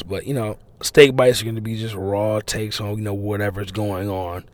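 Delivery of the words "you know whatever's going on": ordinary delivery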